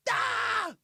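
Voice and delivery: high mock rage shriek; high-pitched freak-out yell; angry scream. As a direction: scream in hammed-up fury. Mad worker screams after the order.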